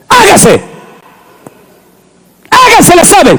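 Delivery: high, ringing divine command; voice of God commanding. Acted. Preacher voicing God's command at creation. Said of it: "todo Dios lo creó con su palabra".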